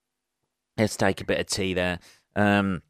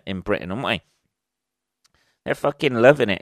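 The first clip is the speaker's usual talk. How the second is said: ordinary talk, nothing marked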